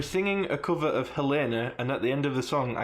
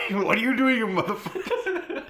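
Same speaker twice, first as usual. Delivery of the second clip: Dumbledore, angry; 8 semitones higher than usual. A narrator's reading. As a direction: yell it in hammed-up outrage, half laughing. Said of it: "Dumbledore walked in angrily".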